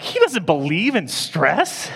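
high, loud, incredulous repeat; indignant disbelief. Retold or imitated. Preacher recalling a stranger's remark, loudly incredulous. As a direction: echo it back in outraged disbelief. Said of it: "I was just angry".

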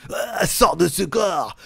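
slow, drawn-out shout; mock exorcist shout. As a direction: banish the spirit, hammed up. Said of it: "Cette voix d'outre-tombe".

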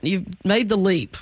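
ordinary delivery

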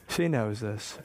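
soft knowing aside. Shown as a demonstration.

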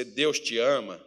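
ordinary delivery

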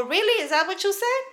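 high-pitched retold challenge; confronting, voice pushed up high; incredulous. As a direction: act out loud, indignant disbelief. Acting out her challenge to boss.

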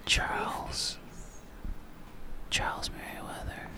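hushed unvoiced whisper. Real, not acted. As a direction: whisper it so nobody wakes. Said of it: "Why are we whispering?".

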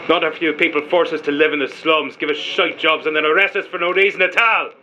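bitter tirade, pitch climbing towards the end; heated, resentful outburst; angry, defiant tone. Acted. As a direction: throw the blame back at your accusers, building. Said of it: "You're gonna watch your tone with me".

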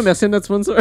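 high, loud sponsor read; ad announcer voice. Pretend. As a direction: pitch it like a cheesy ad. Mock sponsor plug.

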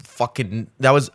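groping for the word; halting, searching for the word; blanking on lines. Real, not acted. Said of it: "completely blanking right now".

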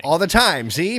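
put-on high, hammy old-time mobster; gangster twenties voice. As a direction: put on a 1920s gangster act. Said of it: "gangster twenties voice for everything".

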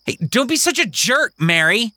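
put-on high, whiny protest of a boy; indignant kid. Kingston protesting to Mary, high indignant whine. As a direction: complain that it's not fair.

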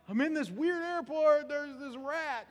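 high voice of a gushing social media post; lightly voiced excited young woman; mock excited poster. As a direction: play the thrilled girl texting friends. Voicing woman's Facebook post.

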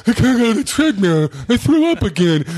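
high, loud, whiny fake crying; whiny mock crying. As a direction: whine it through fake tears. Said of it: "That's a different cry".

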